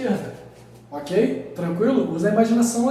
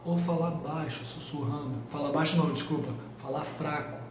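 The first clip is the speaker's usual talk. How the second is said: much softer, almost a whisper; speaking weakly; 5 semitones lower, 10 dB quieter than usual. Shown as a demonstration. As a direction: drop the voice to a faint murmur. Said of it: "a altura não é eu falar mais forte ou falar baixo".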